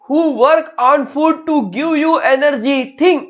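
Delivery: high, slow, insistent question; challenging question. Acted. Brain challenges parts to think. (ऊँची आवाज़ में धीमा, ज़ोरदार सवाल)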